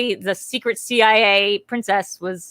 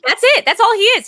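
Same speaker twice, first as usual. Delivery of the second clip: emphatic; 9 semitones higher, 7 dB louder than usual. Real, not acted. Emphatic point about the villain.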